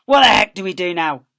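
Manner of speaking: exasperated farmer. Acted. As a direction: demand it of the others. Frustrated farmer.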